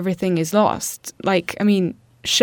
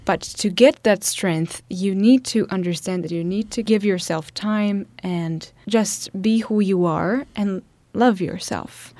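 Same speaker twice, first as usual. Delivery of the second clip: ordinary delivery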